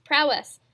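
"Prowess!" called out loud and falling; emphatic correction. Shown as a demonstration. Host correcting his own slip with a loud "Prowess!". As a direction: punch out "Prowess!" as the right word. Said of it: "Actually, it's power".

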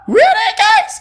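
high-pitched disbelieving question; incredulous. Real, not acted. YouTuber questioning viewers over the card, incredulous. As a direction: squeal it in disbelief. Reacting to another repeat card.

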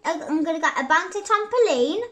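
usual speaking voice

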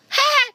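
one high burst of laughter; sharp laugh. Real, not acted. Laughing at his own query pun, high.

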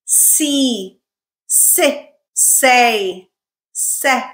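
each "s" syllable said alone with a crisp S; isolated S-plus-vowel syllables with pauses between; syllable drill. Shown as a demonstration. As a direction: model the S blended into each vowel, one syllable at a time. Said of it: "practice this at the syllable level".

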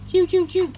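high squeaky whoosh for the cast; mouth sound effect. Acted. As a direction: zip the line out with a high "chiu". Toy fishing, casting sound. (tiếng vút cao)

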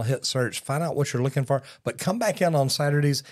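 ordinary talk, nothing marked

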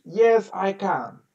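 slow Yes, I can; teacher slows the answer down; slow model answer. Shown as a demonstration. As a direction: say it slowly for the pupil. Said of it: "Can you speak English?".

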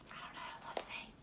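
soft whispered aside. Acted. Teacher whispering to the kids she doesn't know. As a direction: whisper it like a secret.

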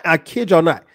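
ordinary delivery

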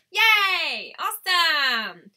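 high, loud, stretched cheering; excited cheer. Real, not acted. Streamer thrilled that five people are watching, excited cheer.

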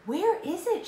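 high, very loud cry; urgent shout. A narrator's reading. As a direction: shout it out as Annie searching. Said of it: "shouted Annie".

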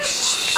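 long, voiceless "shh"; hushing shh. Retold or imitated. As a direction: hush everyone around an angry mom. Mimicking hushed family around seething Mom.

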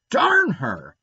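put-on loud angry burst from a sulking boy; explosive outburst. A narrator's reading. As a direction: blurt it out in a furious explosion. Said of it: "exploded Davy".

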